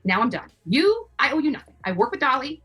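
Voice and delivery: normal talking voice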